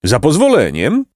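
exclaiming donkey. A narrator's reading. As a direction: butt in with mild outcry. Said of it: "wykrzyknął Osioł".

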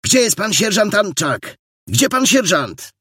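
high-pitched urgent calling out; yelling. A narrator's reading. Detective bursts in looking for the sergeant, yelling. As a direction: cry out for someone, high and alarmed. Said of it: "wydzierał się detektyw".

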